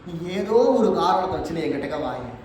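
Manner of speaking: usual speaking voice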